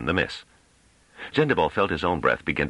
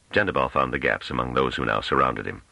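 ordinary delivery

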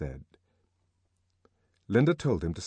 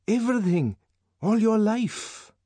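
urgent pleading; 12 semitones higher, 4 dB louder than usual. A narrator's reading. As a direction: press for the whole story. Bernard urges John to explain.

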